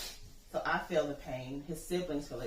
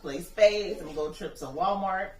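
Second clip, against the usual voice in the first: louder, cheerful teasing; louder joking boast about old age; playful teasing; 3 semitones higher, 8 dB louder than usual. Retold or imitated. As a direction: tease him with a big happy list. Mother teasing son about nursing home.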